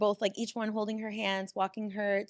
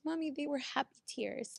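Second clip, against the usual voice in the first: put-on soft child voice; 5 semitones higher, 6 dB quieter than usual. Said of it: "She goes".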